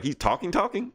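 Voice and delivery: mock double take; stunned disbelief. Guardians hearing Groot talk, stunned and high. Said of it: "And the Guardians are like".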